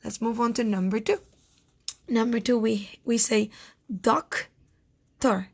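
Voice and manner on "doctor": "doctor" as "doc ... tor", long gap between; doctor, one syllable at a time; split into syllables. Shown as a demonstration. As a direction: break doctor slowly in two. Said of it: "to find the syllables".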